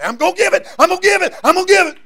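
high, loud eager promising; hammed-up high promise of giving; eager promiser. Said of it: "you got people say".

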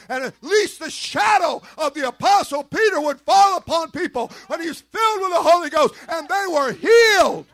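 loud, high preached proclamation; preaching at full force. Acted. Preacher telling how Jesus healed, shouted preaching. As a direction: ring it out over the congregation.

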